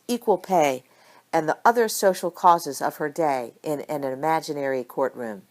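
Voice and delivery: usual speaking voice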